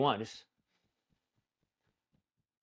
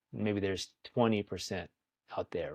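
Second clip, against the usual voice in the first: usual speaking voice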